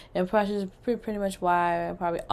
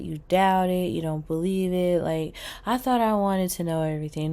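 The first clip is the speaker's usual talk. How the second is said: ordinary delivery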